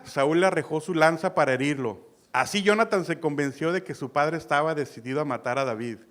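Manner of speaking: ordinary talk, nothing marked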